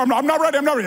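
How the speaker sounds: high, loud mock whine; whiny protest. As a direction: beg off like a kid. He argues with God.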